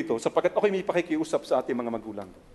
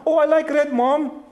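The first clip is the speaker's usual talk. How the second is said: put-on high, louder kid voice; a pitched-up child; imitating a child; 10 semitones higher, 6 dB louder, slower than usual. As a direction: answer eagerly like a small child. Child answers mother in retold scene.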